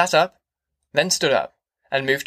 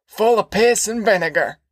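put-on raised, rough old man talking fast about jail; old Grandpa voice; 7 semitones higher, 4 dB louder than usual. A narrator's reading. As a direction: play a boastful old grandpa ranting.

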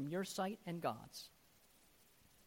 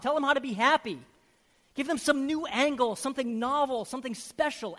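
loud sarcastic listing, higher; hammed-up scorn, ticking off demands; mocking rebuke; 7 semitones higher, 11 dB louder than usual. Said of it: "I felt the Holy Spirit".

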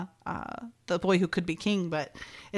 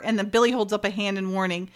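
normal talking voice

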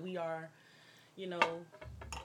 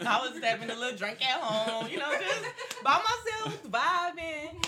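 high, loud, laughing through it; 9 semitones higher, 8 dB louder than usual. Real, not acted. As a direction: giggle while telling it. Laughing while telling story.

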